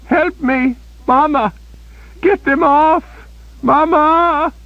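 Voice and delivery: piercing high terrified shriek; loud child-like scream of terror. A narrator's reading. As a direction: shriek for her mother like a frightened child. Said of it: "she shrieked".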